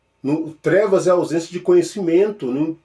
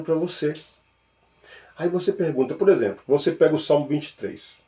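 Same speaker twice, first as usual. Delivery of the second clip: ordinary talk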